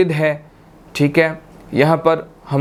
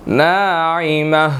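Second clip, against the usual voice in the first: slow drawn-out Naima; slow Quranic recitation; much slower than usual. Shown as a demonstration. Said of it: "teacher recites the word".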